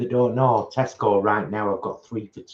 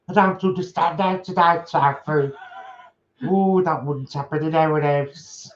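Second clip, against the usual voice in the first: jokey northern English impression; northern accent; 7 semitones higher than usual. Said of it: "Why am I doing you with a northern accent".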